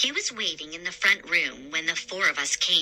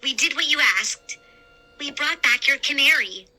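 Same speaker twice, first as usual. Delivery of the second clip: put-on higher, quick eager kid; 7 semitones higher, 3 dB louder, faster than usual. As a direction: rush it out proudly. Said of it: "I said".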